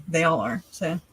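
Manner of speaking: normal talking voice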